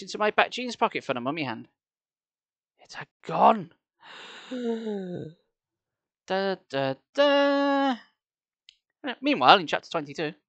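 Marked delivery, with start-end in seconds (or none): 4.2-5.3 long theatrical gasp; exaggerated intake of shock; mock gasp. Narrator reacting to the chapter's cliffhanger, mock gasp. 6.3-8.0 "da, da, da" rising to a held high note; mock dramatic sting. Narrator sending up the chapter ending, mock dramatic.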